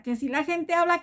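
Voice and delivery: ordinary talk, nothing marked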